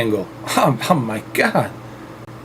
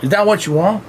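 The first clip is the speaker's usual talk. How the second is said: put-on Southern accent; 3 semitones higher, 5 dB quieter than usual. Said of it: "Stevie Southern accent".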